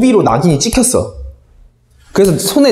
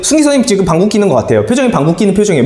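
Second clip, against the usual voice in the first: put-on tattling kid; 5 semitones higher, much faster than usual. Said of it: "뭐 이래".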